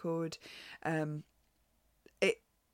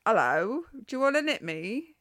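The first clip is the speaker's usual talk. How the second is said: high, loud mock-Cockney greeting; Mockney accent; 8 semitones higher, 7 dB louder than usual. Knitting pattern jumps out at the podcaster, mock Cockney. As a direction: put on a cheeky Cockney voice, pitched up. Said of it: "a Cockney accent or a Mockney accent".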